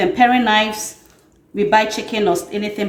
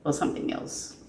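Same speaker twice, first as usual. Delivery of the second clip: near-whispered thinking aloud; muttering to self; 14 dB quieter than usual. Real, not acted. Cook asking herself what ingredient she forgot, muttering to self. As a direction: murmur it very softly, as if searching your memory.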